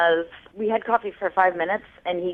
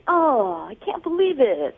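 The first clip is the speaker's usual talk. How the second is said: higher, put-on exasperation; mock disbelief; 6 semitones higher than usual. Caller imitating her suspicious date's excuse, mock disbelief. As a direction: imitate someone play-acting disbelief. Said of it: "he acted like".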